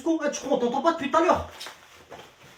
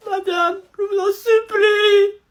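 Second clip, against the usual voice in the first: supplier d'une voix aiguë et cassée; suppliant en pleurs; 7 semitones higher, 4 dB louder than usual. Acted. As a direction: implorer la prof en larmes. Élève supplie en pleurant la prof. (supplication larmoyante)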